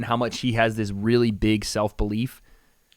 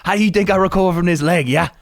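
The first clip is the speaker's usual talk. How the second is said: fast, higher fake Irish brogue; [name]'s mock accent; 5 semitones higher, 4 dB louder than usual. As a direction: rattle it off in a bad Irish accent. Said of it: "I don't know what accent I'm doing".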